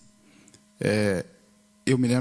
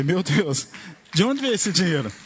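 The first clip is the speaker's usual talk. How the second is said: astonished exclamation; 6 semitones higher, slower than usual. Retold or imitated. Retelling his shock at the bank balance, astonished. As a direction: gasp it out as if the money just appeared. Retelling money suddenly appearing.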